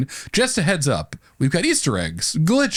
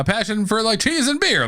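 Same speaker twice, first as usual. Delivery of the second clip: excited; 9 semitones higher than usual. Real, not acted. Jokey push for cheese.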